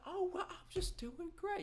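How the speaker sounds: high, put-on polite voice; mock the chipper guy on the phone; imitating a cheerful man. Imitating the phone guy's cheerful voice to his friend.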